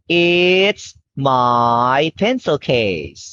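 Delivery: very slow, clear model sentence. Shown as a demonstration. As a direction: spell it out slowly for a child. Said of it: "Okay, now you say".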